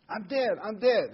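put-on high, loud alarmed cry of an old lady; mock-frantic elderly wife; panicky old wife. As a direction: play the wife sure she has died. Joke, old wife wakes panicking.

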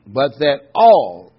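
ALL drawn out slow and loud; stress on ALL. Real, not acted. Preacher to congregation, stressing all. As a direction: lean hard on the word all. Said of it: "emphasize that word all".

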